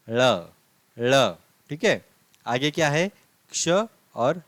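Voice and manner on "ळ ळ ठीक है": ळ said louder, tongue curled back for a retroflex lateral; Marathi retroflex l. Shown as a demonstration. Teacher drilling the Marathi letter for students, a slow, louder retroflex ळ. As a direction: repeat ळ slowly and clearly, with the tongue curled back. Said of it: "इसका pronunciation होता है ळ".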